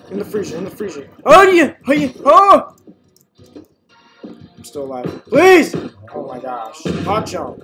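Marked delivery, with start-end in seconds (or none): 1.2-2.8 loud high cheers; excited whoops. Real, not acted. Player gets a kill and cheers loudly. Fighting back in game. 5.3-5.7 loud high begging cry; desperate plea. Acted. Player cornered begs enemy loudly.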